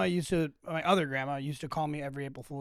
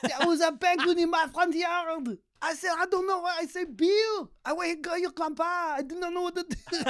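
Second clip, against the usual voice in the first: much higher, hammed-up French accent with laughter; French grandma accent; 11 semitones higher, 5 dB louder than usual. Grandma phoning her grandson with a prank, in a French accent. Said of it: "She was French".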